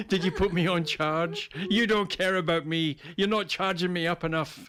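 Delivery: high, laughing mock whine of a neglected robot; whiny robot partner. Robot sulks at its owner for neglecting it, laughing mock whine. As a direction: pout like an undercharged gadget.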